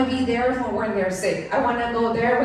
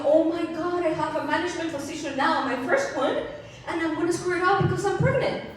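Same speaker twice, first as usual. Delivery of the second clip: mock panic; 6 semitones higher than usual. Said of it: "freaking out".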